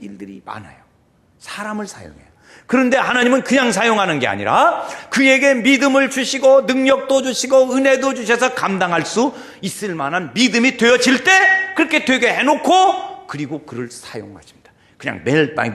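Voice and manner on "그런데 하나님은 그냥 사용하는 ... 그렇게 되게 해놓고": high, loud, driving sermon voice; forceful raised proclamation; full-force preaching. Acted. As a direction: pile up the points loudly, phrase on phrase.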